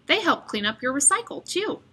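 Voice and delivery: plain reading voice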